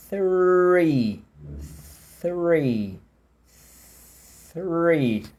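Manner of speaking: "Three" drawn out, tongue between teeth, then the R; exaggerated teaching "three"; slow "three". Shown as a demonstration. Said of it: "vou falar bem devagarinho o número três".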